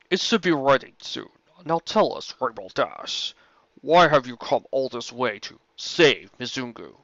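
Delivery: a calm, measured dragon, close to the narrator's own voice; lightly voiced dragon. Dragon Smog greeting Rainbow Dash, lightly voiced and calm. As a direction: play the courteous old dragon, barely changing the voice.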